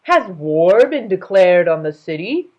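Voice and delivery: loud, indignant royal voice; king demanding of servants, loud; affronted king. Acted. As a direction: push back hard with mock outrage.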